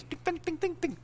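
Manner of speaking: high soft little pings; imitated ding. Teller mimicking fleas landing on his hand, soft high pings.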